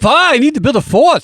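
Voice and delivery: high, loud put-on German kid voice; squeaky German child pleading with Papa. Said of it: "Sure, you do a voice".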